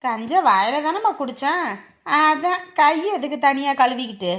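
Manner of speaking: loud cheeky retort. Acted. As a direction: answer back loud and sassy. Daughter talks back to scolding mother.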